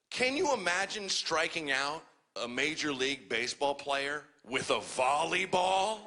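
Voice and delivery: usual speaking voice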